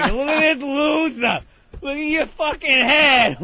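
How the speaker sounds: high, loud, slow taunting yell; mock yell. Retelling the clown taunting a bald man, slow loud yell. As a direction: shout the insult across the park. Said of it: "he's just screaming at him".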